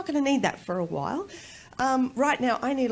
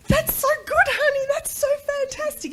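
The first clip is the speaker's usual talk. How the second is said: very high, loud gushing mum voice; hammed-up delight at a little child; gushing praise; 8 dB louder than usual. Gushing praise to her baby son.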